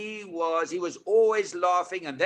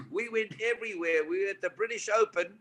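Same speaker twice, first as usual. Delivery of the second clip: ordinary delivery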